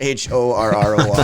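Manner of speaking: each letter of "H O R R O R" spoken loud and separately; spelled out. Shown as a demonstration. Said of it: "spells the word out loud".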